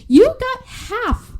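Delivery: high-pitched, drawn-out disbelief; playful indignant scolding; incredulous rebuke. Acted. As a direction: stretch it out in exasperated disbelief.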